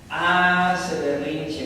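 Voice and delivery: "Hace berrinche" dicho muy despacio, sílaba por sílaba; slow emphatic repeat. Shown as a demonstration. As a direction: stretch out "Hace berrinche" slowly for effect. ("Hace berrinche" muy lento y marcado)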